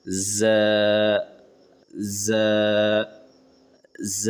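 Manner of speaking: ز held with a buzz, tongue tip to teeth; a clear buzzing ز; buzzing z demo. Shown as a demonstration. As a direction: press ز against the front teeth and let it buzz. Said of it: "آواز میں جھنجھناہٹ ہوگی".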